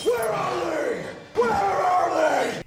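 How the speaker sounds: high, slow shouted mock Batman demand; hammed-up yell at the Joker; Batman interrogation. Batman demands an answer from the Joker, shouted hammed-up. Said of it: "Que o Batman pergunta pro Coringa".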